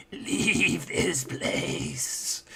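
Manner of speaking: slow, eerie whisper that breaks into a laugh; ghostly whisper. Acted. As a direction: whisper it like a spirit warning them off, barely holding back a giggle. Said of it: "And the voice goes".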